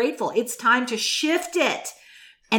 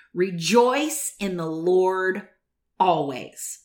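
slow, lower, deliberate rejoice in the Lord always; slow scripture recital; 4 semitones lower, much slower than usual. Acted. Preacher closing her message to the church, slow solemn scripture. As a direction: lay the verse down slowly like a benediction.

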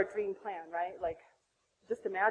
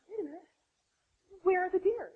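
puzzled question; 7 semitones higher than usual. Retold or imitated. Retold question after the birth.

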